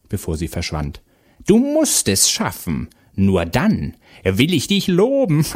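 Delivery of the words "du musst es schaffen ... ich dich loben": high, slow, swooping witch voice; witch calling out. A narrator's reading. Witch warns Vasilisa as she leaves, taunting call. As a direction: stretch the warning out, taunting and witchy. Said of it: "rief die Hexe noch".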